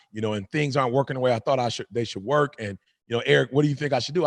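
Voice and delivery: low, soft retelling of someone's troubles; quiet, matter-of-fact quote; retold confiding. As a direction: voice another man's troubles, low and understated. Retells young man's confiding.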